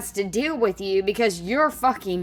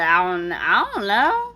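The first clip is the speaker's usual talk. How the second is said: put-on child's uncertain answer; 2 semitones lower than usual. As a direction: echo the kid's helpless answer, stretched out. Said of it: "She said".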